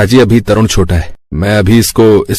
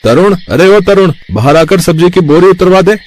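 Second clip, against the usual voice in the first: calling out to brother; 9 semitones higher than usual. Acted. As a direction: shout for the brother from outside, out of breath. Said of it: "भाई तरुण को आवाज लगाता है".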